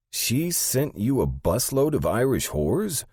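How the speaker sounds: high, drawn-out incredulous question; high-voiced, swooping puzzlement; Sandy, incredulous assistant. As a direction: stretch it out in disbelief.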